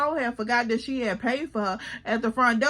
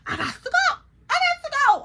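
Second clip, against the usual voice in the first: very high, squealed urge to escape; mock panic; 14 semitones higher, 4 dB louder than usual. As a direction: squeak it out like a comic inner alarm. Acts out panicked thought of leaving.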